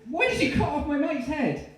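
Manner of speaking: very high, loud panicked demand; horrified shriek. Acted. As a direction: demand an answer in terror. Confronts the killer in horror.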